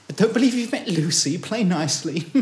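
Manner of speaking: high, chirpy hostess introduction; bossy hostess. Retold or imitated. Hostess dragging the weirdo over to meet Lucy, high and pushy. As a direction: push him onto the guest with a high, pushy hostess voice.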